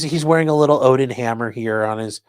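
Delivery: ordinary talk, nothing marked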